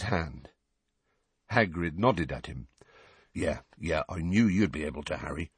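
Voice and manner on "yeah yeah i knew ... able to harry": serious. A narrator's reading. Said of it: "he said seriously".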